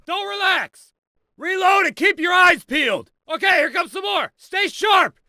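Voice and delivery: very high, strained shouted orders; combat shouting. Acted. Instructor orders recruit to reload during attack, shouting. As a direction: yell it over a firefight.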